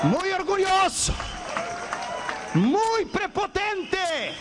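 high, drawn-out denouncing cry; acted accusation. Acted loud accusation before God.

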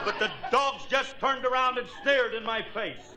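ordinary talk

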